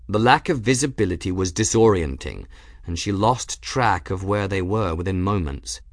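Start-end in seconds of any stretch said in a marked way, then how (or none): none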